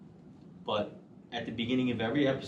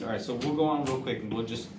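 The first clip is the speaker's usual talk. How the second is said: usual speaking voice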